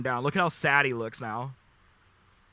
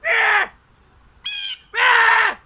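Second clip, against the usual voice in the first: loud, high squawking crow cries; crow yell imitation; 11 dB louder, much slower than usual. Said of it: "They just yell".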